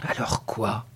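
low, soft, musing aside; talking to oneself. A narrator's reading. Puzzled farmer after the girl's oath, musing to himself. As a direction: mull it over under your breath. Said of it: "comme se parlant à lui-même".

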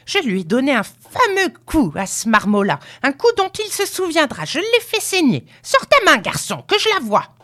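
put-on very high, excited old-woman tirade; furious crowing cry. A narrator's reading. Grandmother boasts of hitting the boy, crying furiously. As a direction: rattle on irately at high pitch. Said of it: "cria-t-elle".

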